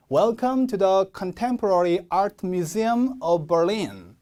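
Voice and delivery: very slow, each word of the sentence stressed; slow model reading. Shown as a demonstration. Teacher leads students reading together, slow careful English. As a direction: read it out word by word for learners. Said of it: "이렇게요".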